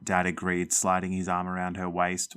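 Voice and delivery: ordinary delivery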